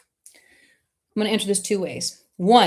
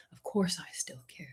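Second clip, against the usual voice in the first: whisper; 13 dB quieter than usual. Retold or imitated. A heart's whispered inner voice.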